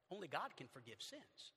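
normal talking voice

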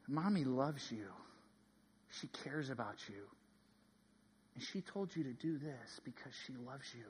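tender retold father-to-daughter talk; gentle fatherly reassurance. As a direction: reassure a small child quietly. Father gently reasoning with small daughter.

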